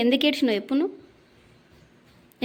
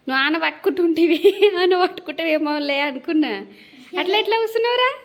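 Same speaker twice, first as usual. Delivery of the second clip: higher-pitched exasperated chiding; real chiding; 5 semitones higher than usual. Real, not acted. Mother asks her little son why he sat like that, chiding. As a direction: chide him, climbing higher on the final question. Mother chides child over accident.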